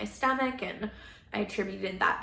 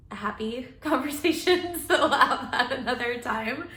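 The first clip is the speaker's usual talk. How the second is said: laughing; 3 semitones higher, 4 dB louder than usual. Real, not acted. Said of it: "and this is a happy conversation too".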